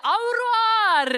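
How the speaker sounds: high, loud and slow au revoir, leaning forward; loud farewell. Retold or imitated. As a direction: belt out au revoir so she hears you. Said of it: "jeg sa det høyt". (høyt og langsomt au revoir)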